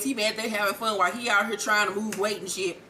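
ordinary talk

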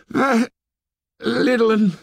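high-pitched gasped words, broken by pauses; gasping. A narrator's reading. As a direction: gasp it out high, fighting for breath. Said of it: "gasped Piggy".

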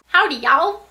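put-on Southern accent. Cowboy greeting.

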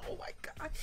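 breathy whispered oh my god; hushed panicked whisper. Real, not acted. Panicked under time pressure.

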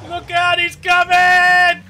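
high, slow, loud warning call; warning shout. Real, not acted. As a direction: warn your friend. Car attacking teammate.

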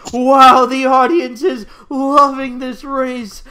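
loud excited commentary. Acted. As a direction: hype up the crowd.

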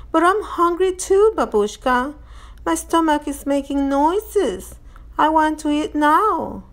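put-on high-pitched childish pleading; complaining little girl. A narrator's reading. Voices hungry child Natasha.

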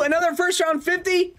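ordinary talk, nothing marked

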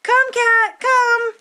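high, loud call; calling the cat. Acted. Dog calling the cat, high and loud.

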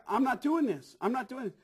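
high, loud retold protest; worked-up quote of putting your foot down; emphatic refusal. Retelling his refusal to perform.

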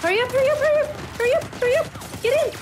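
very high, frantic pleading; high-pitched panic; panicked urging. Real, not acted. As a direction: squeal it urgently, rushing them to safety. Urging game characters to escape.

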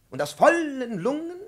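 high and loud, projected from the lungs; full-lunged demo. Shown as a demonstration. Professor showing his pupil how to belt, high and loud. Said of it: "die Töne sehr hoch schmettern".

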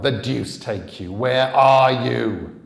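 loud, slow, raging roar; furious growl. A narrator's reading. Gunman cursing the boy who vanished, slow furious roar. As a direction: curse and roar at the empty graveyard. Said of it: "he growled".

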